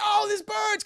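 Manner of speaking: high mock cry of pain; Morbius. Vampire webbed up with garlic, mock agony. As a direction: wail as if burning, hammed-up. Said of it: "Morbius is like".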